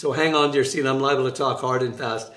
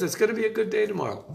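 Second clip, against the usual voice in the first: quoting the Lord; 8 semitones higher, faster than usual. Retold or imitated. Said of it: "and he says".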